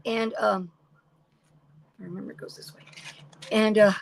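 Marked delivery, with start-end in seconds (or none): 2.0-2.8 barely voiced murmur while working; whisper to self. Real, not acted. Folding boxes, whispering to herself. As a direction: whisper it to yourself.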